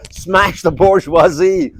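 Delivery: loud, drawn-out mock American slogan; American Spart accent. Spart at uni in Liverpool, loud mock American accent. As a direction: chant it slowly in fake American. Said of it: "doing the American Spart accent".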